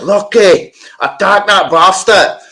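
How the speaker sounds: high, loud, drawn-out shout; retold attack command. Setting Lucky the dog on attackers, retold shouted command. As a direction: holler it as if setting a dog on someone.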